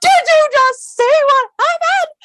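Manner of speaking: high, loud panicked shriek; hysterical. Retold or imitated. Sitting up in bed, asking Phil in a hysterical shriek. Retold panic after aliens leave.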